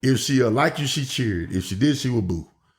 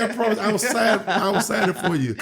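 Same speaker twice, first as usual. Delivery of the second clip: put-on high, laughing reassurance to a kid; sheepish promise; 10 semitones higher than usual. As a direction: whisper a promise through a laugh. Wrestler promising kid an autograph.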